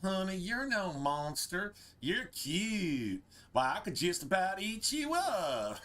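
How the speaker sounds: slow, lightly drawled Southern sweet talk; a hint of Southern drawl, stretched out. Shown as a demonstration. Streamer reads a ghoul's line in a lightly drawled Southern accent. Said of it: "Can you hear this in a southern drawl?".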